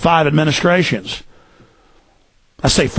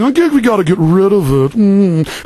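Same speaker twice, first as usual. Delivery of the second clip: higher, mocking snob voice; country-club yuppie; 4 semitones higher than usual. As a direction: parrot it as a self-important yuppie. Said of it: "you're gonna hear yuppies at the country club".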